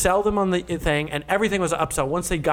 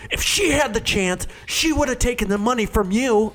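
high-pitched mock indignation; storming supervisor; 8 semitones higher than usual. Supervisor justifying the sale to his salesman, high indignant voice. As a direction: imitate a boss arguing back heatedly. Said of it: "He comes fucking storming out".

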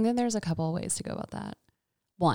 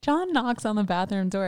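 ordinary talk, nothing marked

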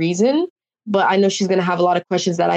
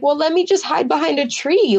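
high-pitched half-joking bargaining; retold playful plea; 9 semitones higher than usual. Player bargaining to watch the challenge, high joking plea. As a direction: plead lightly, pitched well up.

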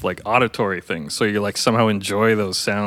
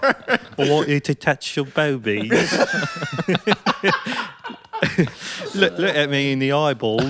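slow, hammed-up British accent with laughter; a lightly voiced British film star; Michael Caine; 3 semitones higher than usual. Riffing a porn exam video in a mock British accent. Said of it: "Michael Caine doing porn".

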